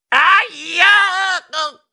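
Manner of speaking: high, loud, stretched ow; yell of pain. A narrator's reading. Narrator bites a pearl in an oyster, yell of pain. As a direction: cry out long and sharp in pain. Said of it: "lanciai un urlo".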